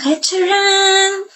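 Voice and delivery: high, slow, drawn-out ta-da; triumphant reveal. Acted. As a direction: unveil the trap with glee. Sister reveals her trap. (masayang pagbubunyag, mataas at mabagal)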